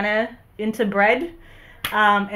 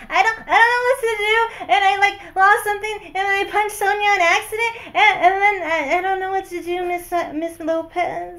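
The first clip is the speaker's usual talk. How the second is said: put-on high, upset little kid voice; eerie ghost child; 11 semitones higher than usual. Shown as a demonstration. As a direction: play a flustered little kid confessing to teacher. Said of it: "She sounds like a goddamn twelve-year-old".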